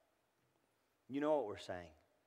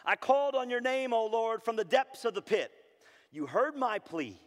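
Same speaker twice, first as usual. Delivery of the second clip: loud, raised cry to God; impassioned scripture reading; 11 semitones higher, 9 dB louder than usual. Acted. Preacher reading Jeremiah's plea from the pit, impassioned cry. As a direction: proclaim the plea high and loud.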